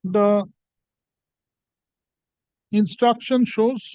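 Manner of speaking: dictating an order slowly; slow dictation with punctuation. Real, not acted. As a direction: spell out the punctuation aloud.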